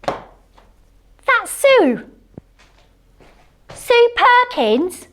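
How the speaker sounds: high, slow and awed; awed recognition, drawn out; starstruck. Real, not acted. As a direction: gasp out the name in wonder. Recognising her idol.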